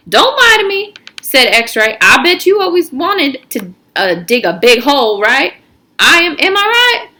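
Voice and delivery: put-on high, loud, pushy teen; cocky teen, high and loud; X-Ray's voice. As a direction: push him, voice up high and loud. X-Ray voiced in story.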